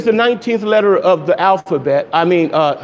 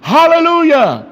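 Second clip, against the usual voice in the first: shouted hallelujah; 5 semitones higher than usual. A narrator's reading. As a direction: shout it to heaven.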